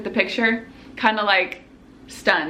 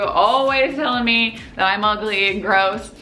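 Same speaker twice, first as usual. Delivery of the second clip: usual speaking voice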